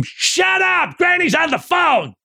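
high, strained mock scream; hammed-up screaming granny; screamed scolding. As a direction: shriek it as an exasperated granny. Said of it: "you hear her scream".

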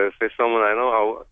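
ordinary talk

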